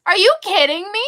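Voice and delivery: loud, high outraged question; indignant disbelief. Real, not acted. Teller outraged that the school loves her attack rabbit, loud. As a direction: fire it off in mock outrage.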